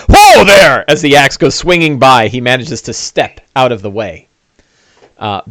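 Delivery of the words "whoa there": loud, high startled orc; orc surprised yelp. Acted. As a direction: jump back with a yelp like the orc. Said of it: "he goes".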